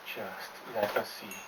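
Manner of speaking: slow, soft mumble to himself; a man lost in thought, mumbling at the window; mumbled. Acted. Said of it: "the man mumbled".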